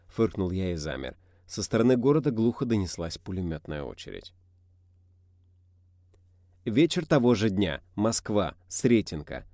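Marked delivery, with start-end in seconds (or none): none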